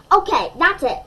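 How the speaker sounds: fed-up mother snapping loudly; mom's exasperated cry. Acted. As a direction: throw up your hands and cry it out. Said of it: "my mom cried".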